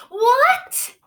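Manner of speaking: high, loud shocked cry; shocked gasp. Acted. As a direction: blurt it out in disbelief. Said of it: "Jameson gasped".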